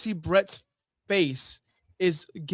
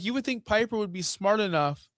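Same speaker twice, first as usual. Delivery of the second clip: usual speaking voice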